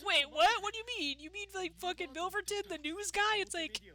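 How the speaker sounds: lightly voiced stunned character; incredulous. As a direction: play it baffled and indignant.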